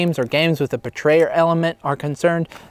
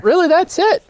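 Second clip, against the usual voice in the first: high squeaky refrain; 13 semitones higher, 3 dB louder than usual. Acted. Catchphrase refrain repeated.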